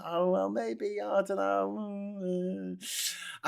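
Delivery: imitating a judge who won't commit; mock dithering. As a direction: stall with vague, drawn-out hesitation. Mock dithering court stalling over Trump.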